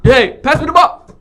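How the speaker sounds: calling out. Pretend. Calls to teammate in game.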